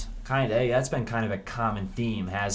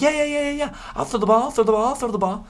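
very high, loud, squealing excitement; giddy quarterback; 6 dB louder, faster than usual. Pretend. Quarterback thrilled to get the ball, squeaky and loud. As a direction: bounce like an overjoyed kid. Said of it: "Cade McNamara is gonna go".

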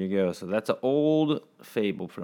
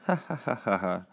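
mock laugh; 3 dB quieter, faster than usual. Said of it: "singing and laughing".

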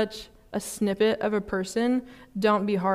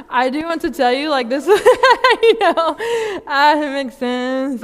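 higher and louder, laughing through the words; real laughter; 6 semitones higher, 9 dB louder than usual. Real, not acted. To the congregation about being a recovering mean person, laughing. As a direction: let the laugh break into the sentence.

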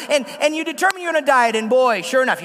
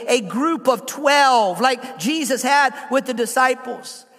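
ordinary delivery

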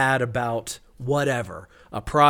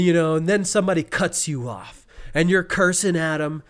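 ordinary talk, nothing marked

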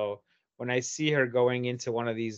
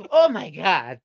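mock startled gasp, louder; imitating a startled mother; 3 semitones higher, 7 dB louder, slower than usual. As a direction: caught red-handed. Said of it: "She goes".

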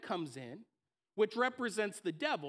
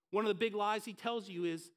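ordinary delivery

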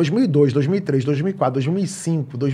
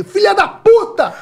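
much higher, drawn-out yelled curse; 4 dB louder, slower than usual. Retold or imitated. Dad screaming at the TV over a missed goal. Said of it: "eu gritei".

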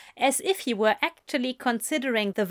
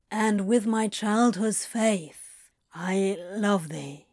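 slow, fading at the end; faltering; slower than usual. A narrator's reading. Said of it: "faltering slightly".

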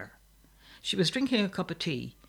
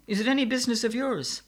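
quick, high challenge; indignant, rushed question; defiant retort; 8 semitones higher, 4 dB louder, faster than usual. A narrator's reading. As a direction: throw it back fast, pitch raised. Sister defies her brothers.

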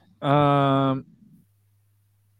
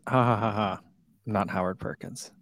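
sarcastic; 4 semitones lower, 6 dB quieter than usual. Real, not acted. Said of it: "you made it sound real sarcastic".

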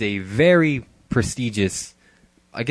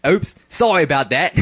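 a light mock Australian accent, a bit higher; 5 semitones higher than usual. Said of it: "give him an Australian accent".

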